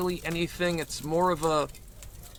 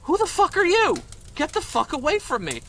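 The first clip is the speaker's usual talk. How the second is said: high, loud snapping brush-off; mock outraged yell, much higher and louder; angry brush-off; 12 semitones higher, 5 dB louder than usual. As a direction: shove him away with your voice. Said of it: "He looks at me and goes".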